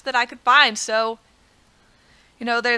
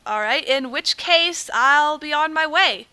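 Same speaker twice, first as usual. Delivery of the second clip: ordinary talk, nothing marked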